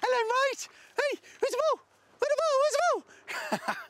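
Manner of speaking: very high squeaky coaxing; high-pitched dog calling. Real, not acted. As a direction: squeal the dog's name to lure him. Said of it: "Call him again".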